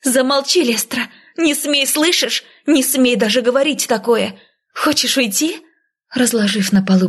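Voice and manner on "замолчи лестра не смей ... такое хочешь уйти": higher-pitched indignant scolding, volume held back; heated but contained reproach; outraged rebuke. A narrator's reading. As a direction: forbid the sister to go on. Narrator acts furious sister's rebuke.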